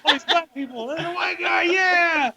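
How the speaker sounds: high shouted crowd cheer; hammed-up excited audience; mock cheering. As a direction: yell it like a rowdy fan. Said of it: "Everyone's excited".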